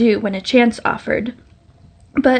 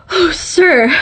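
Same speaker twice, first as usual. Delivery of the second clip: high, louder gasping plea; gasped; 7 semitones higher, 3 dB louder than usual. A narrator's reading. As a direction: gasp it out breathless. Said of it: "she gasped".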